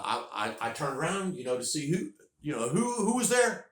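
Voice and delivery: mounting alarm in the retelling; building suspense. Retold or imitated. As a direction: build the tension as he spins around. Rising suspense turning around.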